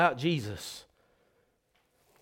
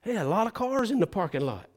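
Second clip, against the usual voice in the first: wondering newcomer; 9 semitones higher, 3 dB louder, faster than usual. Retold or imitated. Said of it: "He said".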